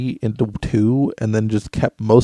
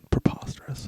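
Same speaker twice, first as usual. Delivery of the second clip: whispering; 11 dB quieter than usual. Real, not acted. Echoing the word quietly.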